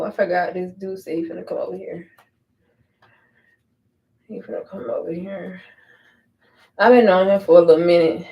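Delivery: ordinary talk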